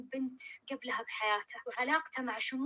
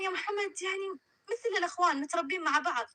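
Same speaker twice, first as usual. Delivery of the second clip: higher, hurried explanation; raised, flustered explaining; 5 semitones higher than usual. A narrator's reading. Umm Rayan explaining to Umm Mohammed, higher and flustered. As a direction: talk over the awkwardness.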